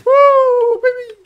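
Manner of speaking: high gleeful whoop; excited whoop. Real, not acted. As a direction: yell it with wild joy. Riding llama in circles.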